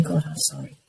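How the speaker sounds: hushed, contrite murmur; sober apology. Retold or imitated. Chastened woman apologizes to God, hushed. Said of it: "I sobered up".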